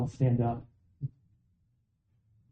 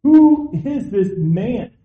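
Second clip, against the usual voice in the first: slow, loud, higher outraged demand; riled up; 8 semitones higher, 8 dB louder, much slower than usual. Retold or imitated. King David, riled up at Nathan's story. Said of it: "David just gets riled up".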